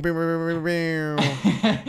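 high rapid "pew pew" noises; playful high-pitched pew sounds; imitated hype sound effect. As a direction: mimic an intro sound effect by mouth. Mimics airhorn after intro.

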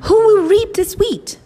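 very high, singsong questioning hen; hen calling out. Acted. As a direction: call high for helpers. Hen calls out for helpers.

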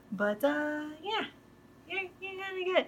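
high, lightly acted hedging; Irvin. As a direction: softly mimic a noncommittal reassurance. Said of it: "He goes".

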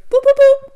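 high, loud playful boops; silly boop sounds. Retold or imitated. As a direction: bop out cheerful boops.

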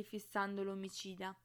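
usual speaking voice

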